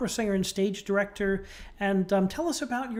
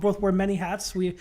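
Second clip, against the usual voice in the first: ordinary talk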